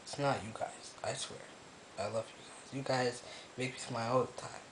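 quiet, tender words to viewers; real soft sincerity; soft reassurance. Real, not acted. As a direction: gently promise you love them. Softly reassuring his viewers.

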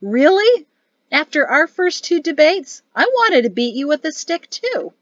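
high, loud, animated outburst; exclaiming. A narrator's reading. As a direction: exclaim with lively astonishment. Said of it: "Mari exclaimed".

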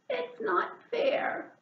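high, slow wailing complaint; cried. Acted. Hedgehog overloaded with stuff, slow high wail. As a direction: stretch it out like a hurt child. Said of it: "cried Hedgehog".

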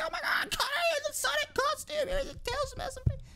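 very high, rushed squealing fan voice; excited fan impression. Gushing fans over a Sonic costume, high squealing mock voice. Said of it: "they're like".

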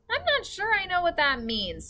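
put-on high, young girl voice; girl's voice. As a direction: read it as the little girl. Sophie's voice in read story.

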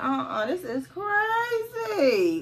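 high, drawn-out mock disbelief; retold disbelief. Customer fed up with the salon, high drawn-out disbelief. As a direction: stretch it out high, exasperated.

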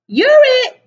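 high, loud playground shout; shrill-pitched game of tag call. A narrator's reading. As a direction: yell it like tagging someone. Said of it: "shouted a shrimp".

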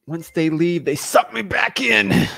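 hammed-up Jersey mobster lament, building to a shout; Tony Soprano. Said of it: "Should I do my Jersey accent?".

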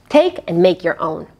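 usual speaking voice

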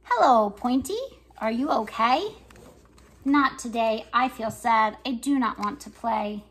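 lively picture-book reading with voices for each character; reading to children. Acted. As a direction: read it like story time for little kids. Reads picture book aloud.

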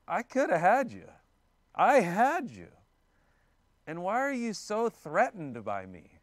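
high, emphatic appeal to be believed; acted-out pleading, punched hard at the start; impassioned protest. David lets Saul know he spared him, impassioned protest.

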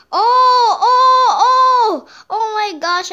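very high, loud repeated cries; alarmed shouting. Real, not acted. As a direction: shout out in a sudden panic. Alarm during game play.

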